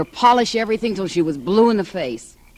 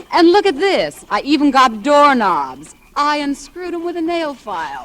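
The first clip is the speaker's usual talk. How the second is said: louder and higher, proudly unveiling the prize; excited show-off; 9 semitones higher, 6 dB louder than usual. Acted. Mistress shows accomplice the stolen doorknobs, excited. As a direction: show him your treasure, thrilled.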